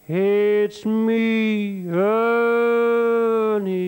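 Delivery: high, loud eerie drawn-out ghost. Retold or imitated. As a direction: answer from beyond, stretched out. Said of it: "ghost replies".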